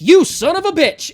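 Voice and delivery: high, very loud mock outrage; furious outburst. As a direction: yell it as if blowing up at the author. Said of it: "I was so mad".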